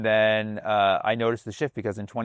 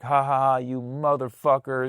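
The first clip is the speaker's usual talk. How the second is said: higher, gloating fake laugh into a taunt; mocking laughter; 4 semitones higher than usual. Comic imitating someone laughing at people's suffering, mocking. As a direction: jeer with a put-on "ha ha ha". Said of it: "laugh, like, at the, the suffering".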